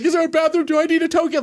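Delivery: high, rushed questions; rattling off. Retold or imitated. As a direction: blurt them out one after another. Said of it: "I'm just rattling stuff off".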